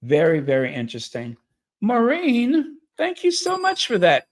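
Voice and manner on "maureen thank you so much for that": pitched way up, gushing thanks; bright high thank-you to a new member; high excited thanks. Real, not acted. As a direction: squeal your gratitude, high.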